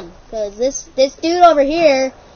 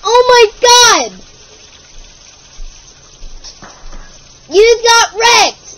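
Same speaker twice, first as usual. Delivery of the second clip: loud, high triumphant yell; gloating shout; 7 semitones higher, 8 dB louder than usual. Real, not acted. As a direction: crow over the win. Gloating after a kill.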